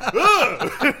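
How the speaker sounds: loud, high, laughing "ugh" of disgust; disgusted yell. Retold or imitated. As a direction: recoil with a laughing shout. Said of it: "And I'm like".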